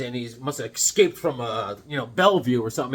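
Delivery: ordinary delivery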